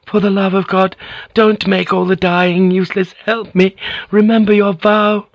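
high, rising, desperate begging; last plea before it's too late. Acted. Mariko begging Blackthorn to remember his vow, desperate.

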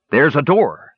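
proud call of discovery; triumphant. A narrator's reading. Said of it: "Sturm said in triumph".

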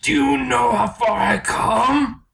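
high, slow, wailing villain shout. Acted. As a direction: rage at the hero in a high shriek. Acting Electro villain, high shout.

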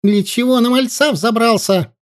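put-on high, shrill-pitched old woman's shout; yelling old woman. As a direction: scold him at the top of her voice. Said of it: "всплеснула руками и завопила".

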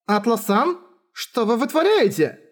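high, scandalized protest; indignant. Acted. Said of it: "Возмущалась Рафталия поведением Атлы".

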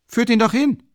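townsman, courage regained, repeating loudly; bolder townsman. A narrator's reading. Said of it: "wiederholte der Kleinbürger".

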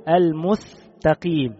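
المستقيم with the س said as ث, like almuthtaqim; المستقيم with a th sound for s; lisped s. Shown as a demonstration. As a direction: say المستقيم lisping the س into a ث. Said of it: "ينطق السين ثاء".

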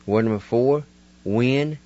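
normal talking voice

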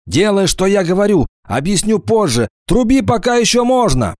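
high, desperate rising urgency; urgent shout. Acted. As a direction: urge her on desperately. Kickaha urges her desperately.

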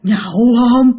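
loud eerie question in an altered voice; ghostly invitation. Retold or imitated. Ghost leaning over dad asking, loud eerie invitation. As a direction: push the invitation out forcefully. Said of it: "Đó, hỏi ba vậy đó".

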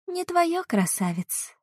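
drawn-out singsong, high start; singsong mermaid. Acted. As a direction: sing it out like a teasing water spirit. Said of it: "протяжно пропела русалка".

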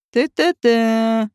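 slow, drawn-out character murmur; musing interjection. A narrator's reading. Вадим rubbing his plump hands, slow knowing tut. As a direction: stretch out each syllable, savouring it.

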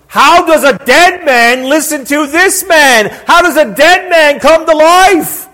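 high, loud rhetorical questions; marvelling pulpit cry; impassioned preaching. Real, not acted. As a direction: throw the question out to the congregation. Preaches with force.

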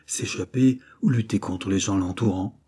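plain reading voice

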